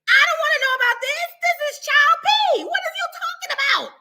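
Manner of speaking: high-pitched mock outcry; horrified protest. Teacher recalls not wanting a student's confession, horrified protest. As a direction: wail it high in exaggerated disbelief.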